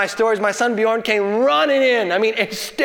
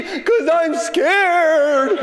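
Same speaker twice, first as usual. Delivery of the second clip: high, very drawn-out mock sob; hammed-up tearful whine; wailing child; 7 semitones higher, much slower than usual. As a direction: imitate a scared, crying little boy. Crying son answers Dad.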